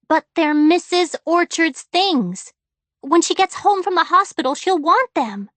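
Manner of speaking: put-on girl pleading for the neighbor's things, high and loud; pleading child. A narrator's reading. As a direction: insist on it, near tears.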